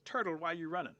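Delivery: high, loud story-character shout; urgent call. Acted. Rabbit questioning fleeing Turtle, calling out urgently. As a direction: Rabbit hailing a runner.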